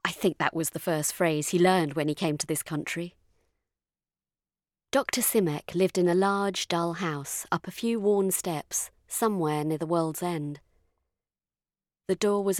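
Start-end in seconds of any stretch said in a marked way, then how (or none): none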